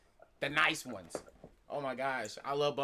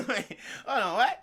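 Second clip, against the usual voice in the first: ordinary delivery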